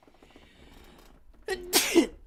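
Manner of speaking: sharp real cough; a sudden hard cough. Real, not acted.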